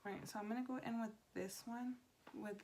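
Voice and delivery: soft, hushed talk; quiet at night. Real, not acted. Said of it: "talking a lot lower than I usually do".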